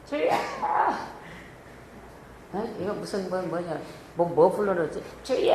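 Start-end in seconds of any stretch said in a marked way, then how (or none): 0.1-0.3 strangled throat cry. Shown as a demonstration. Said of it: "여기서 해". 5.2-5.5 strangled throat cry. Shown as a demonstration. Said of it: "한단 말이에요".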